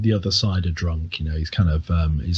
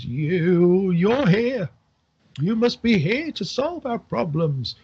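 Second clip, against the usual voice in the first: put-on high, slow drunken old man, rambling; a tipsy old villager pleading for help; 7 semitones higher, slower than usual. Rambling drunk old man begging the heroes for help.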